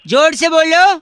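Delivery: loud, high yell; shouting. Acted. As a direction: yell it down the line. Caller yells at the victim.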